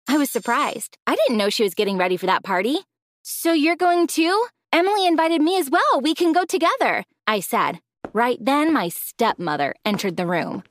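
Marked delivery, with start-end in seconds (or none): 3.2-7.0 put-on high, bright hopeful girl voice; eager young girl. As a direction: lift it with eager hope. Amelia excited about party.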